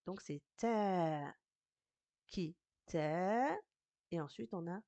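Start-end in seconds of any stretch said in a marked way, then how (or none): 0.6-1.3 "ta" with a long held vowel; slow, sustained "ta". Shown as a demonstration. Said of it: "elle a une longue voyelle". 2.3-3.6 short "ki", then "ta" held long; long vowel. Shown as a demonstration. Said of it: "elle a une longue voyelle".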